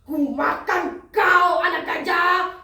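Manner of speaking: loud, slow threat into booming laugh; menacing crocodile. Acted. Crocodile opens jaws at baby elephant, loud menacing threat. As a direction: roar the threat slowly, then laugh big. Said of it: "membuka mulutnya lebar-lebar dan berkata".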